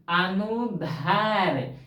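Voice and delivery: अनुदैर्घ्य very slow, the धा sound drawn out; slow demo of अनुदैर्घ्य that brings out the dha sound; stress on धा. Shown as a demonstration. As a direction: sound out अनुदैर्घ्य, leaning long on its dhai syllable. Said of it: "धा बोलाया ना".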